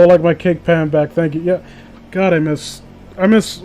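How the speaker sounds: ordinary talk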